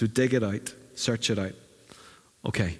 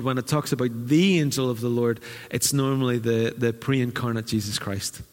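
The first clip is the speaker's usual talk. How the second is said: usual speaking voice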